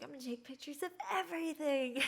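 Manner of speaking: high, quick gush of excitement; a giddy fourteen-year-old gushing; excited kid. Retold or imitated. Excited teen tourist quoted.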